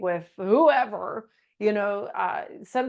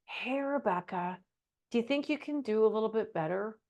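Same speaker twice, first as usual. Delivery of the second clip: softer, hesitant team lead hedging a request; timid nice boss; 5 dB quieter than usual. Shown as a demonstration. Team lead gently asking an underperformer, softer and hesitant. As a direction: tiptoe around the criticism, softly. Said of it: "this is what nice sounds like".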